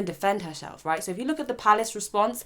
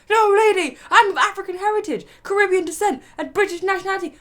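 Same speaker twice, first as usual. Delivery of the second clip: much higher and louder exasperated correction; nearly yelling, fed up with the question; exasperated reply; 13 semitones higher, 7 dB louder than usual. Retold or imitated. Host voicing the visitor correcting the lady, loud and exasperated.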